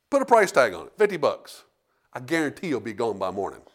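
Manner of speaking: ordinary talk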